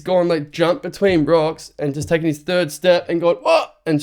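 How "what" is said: sudden, much higher startled what; surprised what. Retold or imitated. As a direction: jolt up high as if the glove just appeared. Finding the lost glove, stunned.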